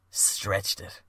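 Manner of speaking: usual speaking voice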